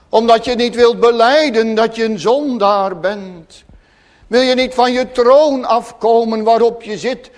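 loud, impassioned pulpit voice; fervent preaching. Acted. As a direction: preach it out with full conviction. Preacher in full flow. (vurig preken, luid en hartstochtelijk)

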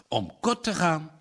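ordinary delivery, nothing marked

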